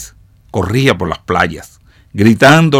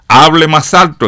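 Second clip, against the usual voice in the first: higher, louder call from the audience; exclaimed heckle; 6 semitones higher, 5 dB louder than usual. A narrator's reading. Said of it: "exclamó otro".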